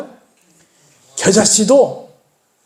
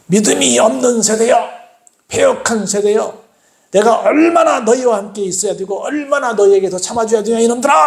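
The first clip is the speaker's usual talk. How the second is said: 역정 내는 꾸짖음; 4 semitones higher than usual. Acted. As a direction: scold the disciples in exasperation, pitch climbing. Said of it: "역정을 내시면서". (역정을 내며 꾸짖는 말투)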